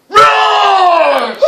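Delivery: high, loud pretend roar; mock beast roar. Teacher startles the class in the jungle game, mock roar. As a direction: roar suddenly to startle them.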